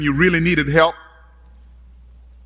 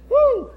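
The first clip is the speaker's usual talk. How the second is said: high whoop. Real, not acted. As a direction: let out a high preacher's whoop. Preacher's exclamation.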